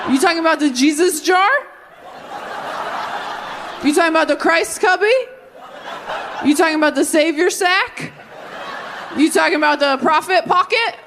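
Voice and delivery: high, mocking churchman asking who Mary is; dismissive churchman. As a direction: play the oblivious men of the congregation. Men mocking Mary's role.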